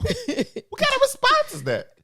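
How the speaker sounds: high, slow mock outrage; mock indignant. Mock offended at reply.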